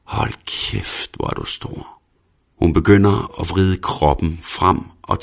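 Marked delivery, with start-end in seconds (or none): 0.0-2.0 soft, moaning whisper; stønnende hvisken. A narrator's reading. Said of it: "mens hun hvisker stønnende". (hviskende og stønnende)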